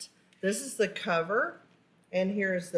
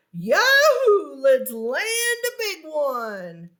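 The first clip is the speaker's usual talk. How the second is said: very loud, high, slow cheer; loud joyful exclaim; 6 semitones higher, 6 dB louder, slower than usual. Acted. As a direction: yell it out over the lake with joy. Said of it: "every time he exclaimed".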